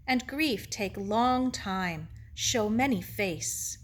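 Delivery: slow Japanese-accented elder, lightly voiced; compassionate Mister Ito. Ito explains grief to Sarah, slow and compassionate. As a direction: draw the phrases out patiently.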